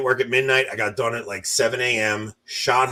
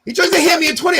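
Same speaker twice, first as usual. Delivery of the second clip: shouted, pitched way up; outraged shout; 6 dB louder, faster than usual. Real, not acted. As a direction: yell it in disbelief.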